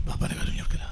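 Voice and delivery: hushed, breathy whisper with stress on കൊടുക്കല; secret-sharing whisper. Acted. Same phrase loud then whispered.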